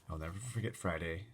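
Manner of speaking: usual speaking voice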